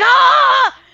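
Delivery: high, loud shriek of fright; startled scream. Retold or imitated. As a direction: shriek as the frog nearly hits the bed. Frog nearly lands on bed.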